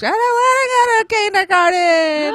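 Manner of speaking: put-on high, loud whine of a protesting little girl; wailing child impression. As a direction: imitate a child's tearful tantrum. Imitating daughter refusing to go to kindergarten.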